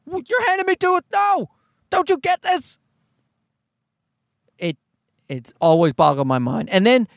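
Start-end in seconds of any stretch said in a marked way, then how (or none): 0.1-2.8 high-pitched frantic protest; mock panic; panicked child. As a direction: play a terrified kid pleading, very high. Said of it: "So they're just like".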